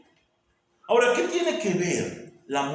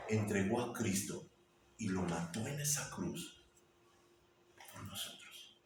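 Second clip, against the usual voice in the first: much softer, sinking into a whisper; solemn hush; 7 semitones lower, 14 dB quieter than usual. Acted. Preacher on God giving Christ for us, solemn whisper. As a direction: hush the words to nothing.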